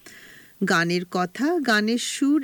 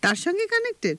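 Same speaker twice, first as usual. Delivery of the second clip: surprised; 10 semitones higher, faster than usual. Real, not acted. Puzzled by the quiz hint.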